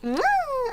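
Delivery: high, playful air kiss; kiss sound. Real, not acted. Blowing a kiss to viewers.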